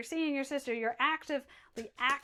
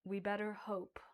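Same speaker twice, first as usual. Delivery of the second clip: softer, lower menacing murmur; a huge demon muttering to his minions; low quiet boss voice; 6 semitones lower, 13 dB quieter than usual. Acted. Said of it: "he goes".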